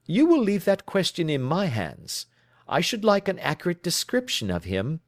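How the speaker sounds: lightly voiced, crisp questioning detective; brisk detective. Holmes questioning his client, crisply. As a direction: probe her story, brisk and in command.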